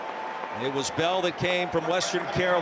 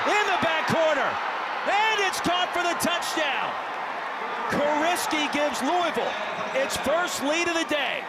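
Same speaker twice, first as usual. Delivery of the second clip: higher, excited scoring call; touchdown call; 6 semitones higher than usual. Real, not acted. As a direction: ride the play up to the touchdown.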